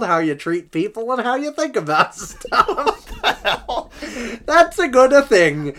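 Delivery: high, loud hammed-up Swedish accent drifting between accents; mock Swedish accent. Host mocking Klingberg's quote in a hammed-up Swedish accent. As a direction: play a Swedish hockey player giving a statement, laughing through it. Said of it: "Swedish to Italian, back to Swedish to, like, Icelandic to Minnesotan".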